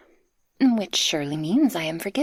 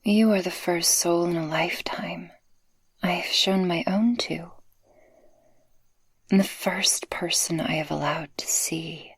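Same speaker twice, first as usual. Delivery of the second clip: tender whisper. Acted. Speaker confessing love to the listener, tender whisper. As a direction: open your heart in a hush.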